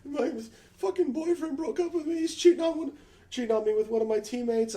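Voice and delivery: hammed-up sobbing girlfriend voice; imitating crying girl. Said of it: "she's crying".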